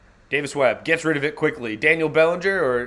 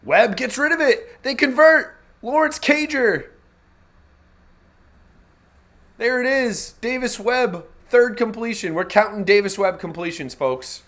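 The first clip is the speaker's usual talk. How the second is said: high, loud announcer call on a completion; excited play-by-play; 11 semitones higher, 5 dB louder than usual. Real, not acted. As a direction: call it like a live broadcaster, thrilled.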